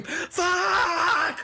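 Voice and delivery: sharp, high, shouted curse; retold frustrated scream. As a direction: blurt the curse out like you're losing it. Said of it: "I was fucking screaming".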